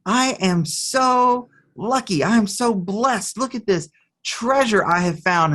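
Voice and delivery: hammed-up, awed excitement; delighted child. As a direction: act out a kid lighting up at a treasure. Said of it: "he lights up and he says".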